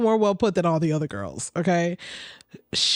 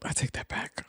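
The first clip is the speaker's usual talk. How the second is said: whispered retraction; 11 dB quieter than usual. Real, not acted. Aside after correcting herself.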